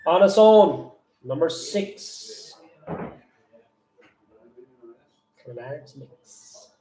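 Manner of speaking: loud sing-song bingo calls; bingo caller. Acted. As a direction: call the numbers like a bingo hall. Bingo number calling.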